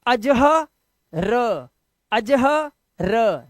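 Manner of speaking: अजहर broken as अ-ज-ह, long gap, then र; wrong split reading. Shown as a demonstration. Teacher asking if we read it like this, wrong split reading. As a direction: drag out अजह and leave र stranded on its own. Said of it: "क्या इसे ऐसे पढ़ते हैं".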